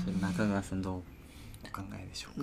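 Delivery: ささやき声. Acted. 急にひそひそ声で話す.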